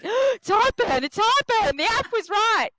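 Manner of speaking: very high, loud, slow exclaim; husband excitedly confirming heartburn; mock excited announcement. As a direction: squeal it like big news. Partner voiced excitedly.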